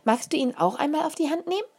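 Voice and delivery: put-on high, quick child's offer; eager little girl. A narrator's reading. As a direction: ask brightly like a small child. Child offering beetle to adult.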